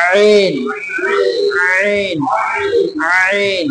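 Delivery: আইন pressed from the middle of the throat; repeating আইন for the class to copy; throat-pressed ain. Shown as a demonstration. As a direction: give each আইন a firm throat squeeze. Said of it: "একটু চাপ দিয়ে পড়লেই হবে".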